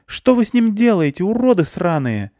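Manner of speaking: very high, loud furious screech; furious yell. A narrator's reading. Klyusya curses the captors, furious yell. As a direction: scream abuse at them.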